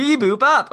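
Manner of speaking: high loud robot beeping; robot beeps. Pretend. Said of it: "sound like a robot".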